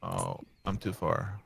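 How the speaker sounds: ordinary talk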